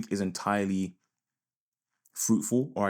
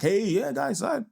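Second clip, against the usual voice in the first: much higher, squeaky hammed-up influencer voice; mock vlogger; 4 dB louder, slower than usual. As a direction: parody an attention-seeking vlogger going live. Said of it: "I'm like".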